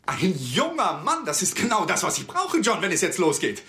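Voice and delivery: excited outburst. Acted. Lawyer telling John about the student, overjoyed. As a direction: burst out with delight at the news.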